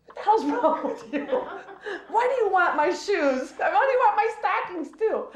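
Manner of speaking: put-on high scolding yell like at a kid brother; exasperated high yell; retold yelling. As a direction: shake him and yell, big sister style. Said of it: "I'm yelling at him like he's my little brother".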